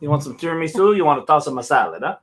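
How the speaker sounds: put-on Italian accent. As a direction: ham up a joking Italian accent. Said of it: "[name]'s Italian accent is on point".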